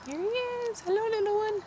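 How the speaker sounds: high, soft cooing greeting. Real, not acted. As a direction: coo to the tiny caterpillar. Greeting caterpillar.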